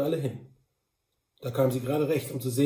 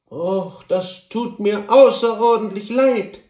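high, slow, theatrical regret; saddened Kantor; 7 semitones higher, 9 dB louder, slower than usual. A narrator's reading. The Kantor admits he is sorry, mildly theatrical distress. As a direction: lament it slowly and emphatically. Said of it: "gestand der betrübte Kantor".

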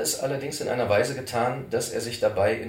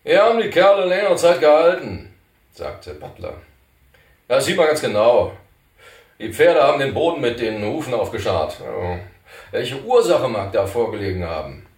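a touch higher, louder rough frontiersman; Butler's voice; 4 semitones higher, 10 dB louder than usual. Acted. Butler puzzling over the hoof marks, a bit louder. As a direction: speak up like a wary trail boss.

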